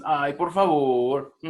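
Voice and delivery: loud, drawn-out mock plea; pleading. Shown as a demonstration. Teacher demonstrating a plea to students, exaggerated pleading. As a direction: beg with long stretched vowels. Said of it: "Ruegos, cuando dices".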